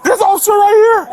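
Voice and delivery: anguished accusing shout. Real, not acted. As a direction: call out the officer to everyone. Man crying out after beating.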